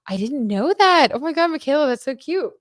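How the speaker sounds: thrilled at a cute discovery; delighted surprise. Real, not acted. Delighted reaction to surprising news.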